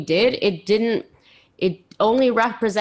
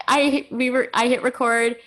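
ordinary talk, nothing marked

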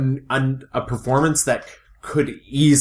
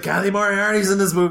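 excited; 8 semitones higher than usual. Retold or imitated. Excitedly texting friend about actress.

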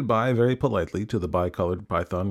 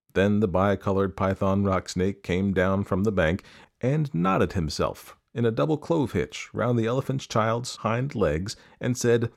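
plain reading voice

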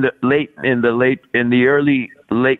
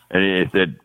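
normal talking voice

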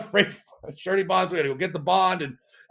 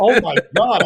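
loud, higher burst of amazement; elated reaction; 4 semitones higher, 8 dB louder than usual. Retold or imitated. Founder recalling his first freight deal, elated. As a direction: blurt it out, re-enacting elation.

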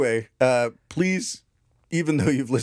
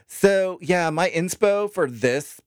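mock LA influencer; 6 semitones higher, slower than usual.

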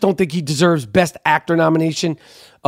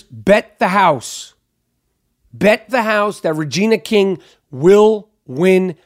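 slow confident; slower than usual. Real, not acted. Confident prediction.

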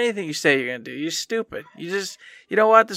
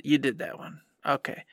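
usual speaking voice

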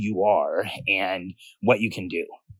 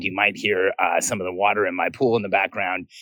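ordinary talk

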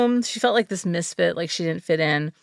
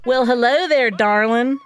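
exaggerated Southern drawl, pitched up; syrupy Southern accent; 3 semitones higher, 4 dB louder than usual. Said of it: "a syrupy and exaggerated Southern accent drawls".

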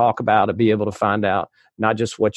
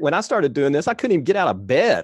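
higher, quicker excited confession; animated; 8 semitones higher, faster than usual. Real, not acted. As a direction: rush it out pitched up. Admits early shyness.

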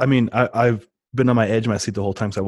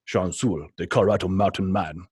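put-on Pavel's Russian accent. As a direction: imitate a Russian audiobook reader, lightly. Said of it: "there's Pavel saying my name".

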